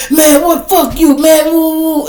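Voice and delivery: loud, high defiant yell; drunk yelling back. Retold or imitated. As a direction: shout him down mockingly.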